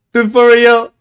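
exasperated disbelief. Real, not acted. Streamer reacts to the crash with loud disbelief.

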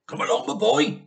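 put-on eager call to the boy; calling out keenly; cried out. A narrator's reading. Dad urging George on at the stove, eager call. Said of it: "cried Mister Killy Cranky".

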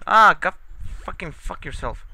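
loud, high furious outburst; angry yell. Real, not acted. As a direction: explode at the game. Raging at game.

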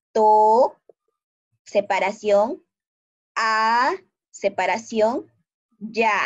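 "to", "a", "ya" each held out as its own syllable. Shown as a demonstration. Teacher splits toalla into syllables for the class, syllable by syllable. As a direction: break it into "to", "a", "ya" with clear gaps. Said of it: "cuando yo lo separe en el silabeo".

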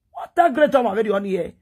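ordinary talk, nothing marked

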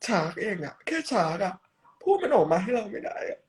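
hammed-up hurt outrage at the audience; mock upset. Cute character scolding viewers for not saying shark, mock hurt.

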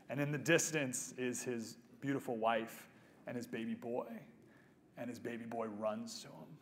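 quietly moved, on the edge of tears; tearing up. Real, not acted. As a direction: hold back real tears as you tell it. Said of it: "sorry, I'm tearing up".

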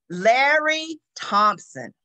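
ordinary delivery

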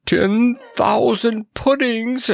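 very high, drawn-out outraged squawk; furious scream. A narrator's reading. Monarch throws the clown's apology back, in a high furious screech. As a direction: screech it slowly in high-pitched fury. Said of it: "screamed Mustafa furiously".

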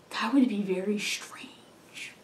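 slow, breathy whisper; slow whispered musing; whispered aside. Real, not acted. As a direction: let it slip out as a hushed thought.

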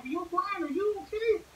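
high, concerned call; worried classmates. Retold or imitated. Classmates asking sweating Brian, high concern. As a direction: voice classmates checking on him. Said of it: "Everybody was like".

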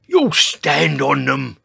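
loud, slow objection; protest. A narrator's reading. Dinosaur objecting to the shelter plan, loud slow protest. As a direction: object loudly in a gruff character voice. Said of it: "protested George".

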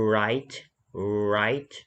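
drawn-out rounded r opening "write"; rounded initial R. Shown as a demonstration. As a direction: purse the lips slightly and hold the r of "write". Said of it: "หอปากนิดนึง".

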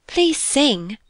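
put-on high, eager girlish plea; lifted, imploring request; pleading. A narrator's reading. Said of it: "pleaded Bella".

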